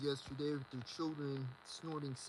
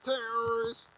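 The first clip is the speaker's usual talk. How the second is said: higher and louder cry; raised alarmed yell; alarmed shout; 7 semitones higher, 8 dB louder than usual. Real, not acted. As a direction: call out the threat in alarm. Alarmed outcry about terrorists.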